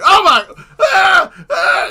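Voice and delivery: very loud, high, drawn-out screams; high shrieking in mock horror; imitated yelling. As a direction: scream like an alarm thing going off. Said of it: "and it starts yelling".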